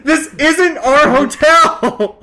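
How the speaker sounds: high, drawn-out mock yell with a laugh; exasperated calling out. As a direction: call it after someone, stretching every word. Retold exasperated call after passenger.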